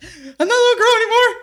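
high, loud, fast sobbing protest; mock wail. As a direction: ham up a crying girl announcing she's grown. Repeat of retold wail.

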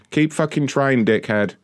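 ordinary talk, nothing marked

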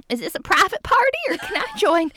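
high-pitched Southern lilt; little Southern accent. Retold or imitated. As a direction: play a sweet Southern belle. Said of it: "little Southern accent".

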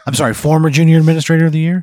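fast, high, loud question; incredulous correction. Acted. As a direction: rattle off the title in disbelief. Acted dispatcher correcting in amazement.

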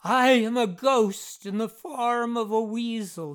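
threatening. A narrator's reading. Jeff threatens the Irvings, loud and menacing. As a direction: proclaim it as a ghostly threat. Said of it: "he threatened".

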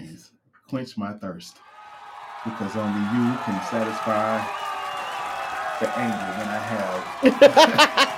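prayer building to loud; mock prayer. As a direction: swell from a plea to a loud cry.

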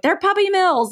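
high mocking echo of the critics. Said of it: "everybody's like".

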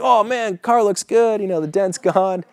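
high, admiring "oh man" as an easygoing neighbor; pleased neighbor. Retold or imitated. Upbeat neighbor admires his car.

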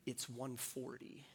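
soft, slow, hushed aside; retold whisper. Other caddy tipping him off on the sly, hushed and slow. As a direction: murmur it low like a secret tip. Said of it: "comes by me and whispers in my ear".

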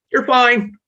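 high, loud brush-off; dismissive parent. Retold or imitated. Dismissive parent to a crying child, loud high brush-off. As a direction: wave the child's pain away. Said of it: "they're sitting there going".

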